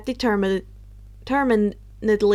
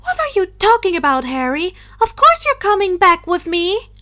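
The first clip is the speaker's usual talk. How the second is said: high, loud, fast disbelief; shocked exclaim; 7 semitones higher, 8 dB louder than usual. Acted. Luna protests to Harry in loud shock. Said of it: "Luna exclaimed in shock".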